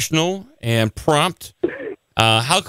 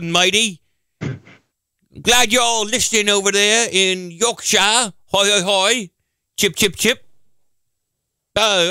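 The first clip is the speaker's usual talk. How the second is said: higher-pitched hammed-up British accent; fake British accent; 7 semitones higher than usual. Radio host greeting UK listeners in a fake British accent. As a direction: put on a silly, cheery British voice. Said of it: "your fake accent".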